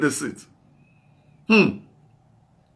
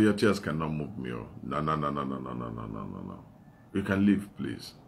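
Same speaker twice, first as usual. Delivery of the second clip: much softer and lower, a calm final no; firm quiet refusal; 11 dB quieter than usual. Retold or imitated. Preacher retelling how he softly refused the woman. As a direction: drop to a hushed, steady refusal.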